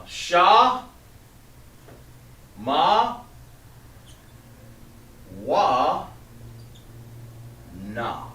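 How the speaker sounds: Sha, Ma, Wa, Na, each called out with long pauses; syllable by syllable. Shown as a demonstration. Teacher writing each syllable on the board while saying it. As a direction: dictate one syllable at a time. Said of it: "like that".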